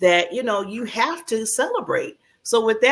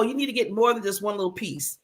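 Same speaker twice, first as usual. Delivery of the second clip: ordinary talk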